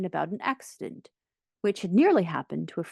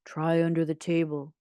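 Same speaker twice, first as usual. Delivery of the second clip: ordinary delivery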